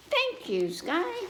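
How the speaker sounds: lightly voiced little pig, rising high; sweet thankful child; Peppa voice. Peppa thanking the sky, light childlike voice. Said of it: "said Peppa".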